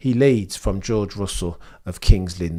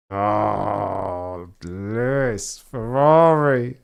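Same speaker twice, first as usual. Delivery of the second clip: long wailed groan swelling louder on the last word; drawn-out lament; 5 dB louder, much slower than usual. Real, not acted. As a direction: stretch it out, rising to a loud lament. Drawn-out lament at Ferrari's blunder.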